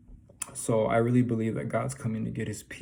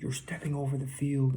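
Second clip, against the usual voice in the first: ordinary delivery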